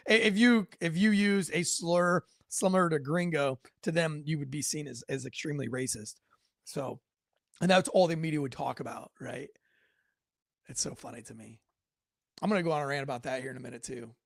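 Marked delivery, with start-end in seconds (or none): none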